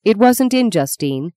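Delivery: ordinary delivery, nothing marked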